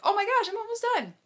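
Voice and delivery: high, fast, excited gasp of relief. Retold or imitated. Swimmer near the finish telling herself, high excited rush. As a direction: squeal it like the finish is in sight. Retelling finishing the race swim.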